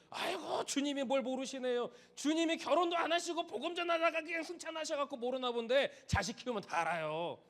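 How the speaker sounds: high, loud know-it-all whine; a parent brushing off the Lord, hammed-up and condescending; sarcastic, loud protest; mocking excuse. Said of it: "그때 가서도".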